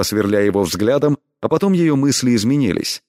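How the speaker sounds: usual speaking voice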